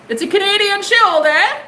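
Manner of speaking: high, hammed-up mock Canadian; exaggerated Canadian accent. Reader teasing a Canadian viewer about the Shield, exaggerated Canadian accent. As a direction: push the Canadian accent up high, big rising "eh". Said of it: "God, it's so offensive".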